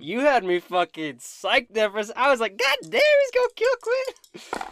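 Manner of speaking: very high, very loud disbelieving yell; hollering in stunned excitement; real excited outburst. Real, not acted. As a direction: explode with amazement, climbing into a stretched shriek. Game master relieved at plot twist.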